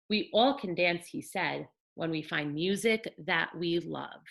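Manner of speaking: put-on gentle read-aloud for children; storybook narration for little ones; bedtime story reading. A narrator's reading. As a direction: tell it like a picture book at bedtime.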